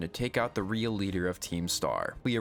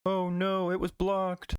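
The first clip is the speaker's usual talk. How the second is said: higher, hammed-up cartoon whine; mock dismay; 9 semitones higher, slower than usual. Pokemon whining that its attack got blocked, higher mock dismay. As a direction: whine like a defeated cartoon monster.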